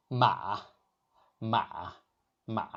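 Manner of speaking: MA dropping low, then lifting slightly; third tone. Shown as a demonstration. Teacher demonstrating to learners, MA in a dipping third tone. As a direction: dip MA into a low valley. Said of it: "Your voice drops".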